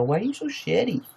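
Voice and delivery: high cooing scold to a pet. Real, not acted. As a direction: coo at the shedding cat. Talking to the cat.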